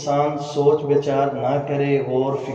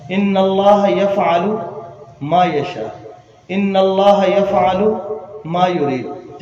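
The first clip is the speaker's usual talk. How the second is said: slow recitation, raised openings, falling closes; Quran recitation; 3 semitones higher, 4 dB louder, slower than usual. Acted. Preacher citing Surah Hajj to listeners, slow projected recitation. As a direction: recite each verse slowly, lifting then settling.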